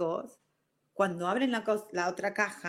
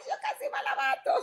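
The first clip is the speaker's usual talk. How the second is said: much higher, laughing through the words. Real, not acted. Recalling the rude cashier to her audience, laughing high. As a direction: laugh it out in disbelief.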